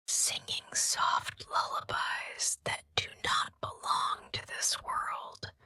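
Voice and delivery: plain reading voice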